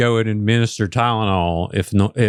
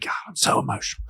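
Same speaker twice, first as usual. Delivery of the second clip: mock whisper; 7 dB quieter, much faster than usual. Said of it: "I'm like".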